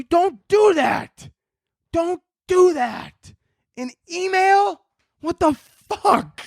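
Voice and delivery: high, slow, drawn-out disbelief; exasperated plea. Retold or imitated. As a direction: stretch each word out high like you can't believe it. Exasperated with dad's false alarm.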